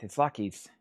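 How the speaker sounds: low and quiet through gritted teeth; gritted-teeth Clint. Retold or imitated. Seller imitates Clint Eastwood to buyers, low through teeth. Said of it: "he's always gritting his teeth".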